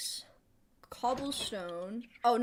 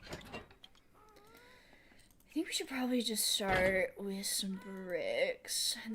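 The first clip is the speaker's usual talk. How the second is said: whisper. Real, not acted. Sudden whispered planning.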